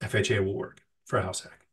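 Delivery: usual speaking voice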